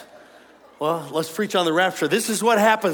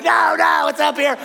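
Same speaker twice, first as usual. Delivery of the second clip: crowd shouting back; 7 semitones higher, 6 dB louder than usual. Retold or imitated. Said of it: "You're like".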